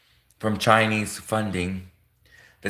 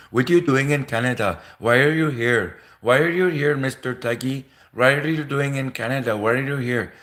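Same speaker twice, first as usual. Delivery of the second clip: mock Indian accent, raised in pitch; 4 semitones higher than usual. Indian immigrant challenging someone on being in Canada, mock Indian accent. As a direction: put on a thick Indian accent. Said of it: "So if you hear an Indian be like".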